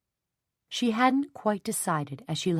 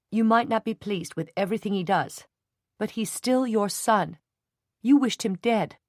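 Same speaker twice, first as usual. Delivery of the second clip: angry scolding; 5 semitones higher, 3 dB louder than usual. A narrator's reading. Jenny scolding the Hendrons.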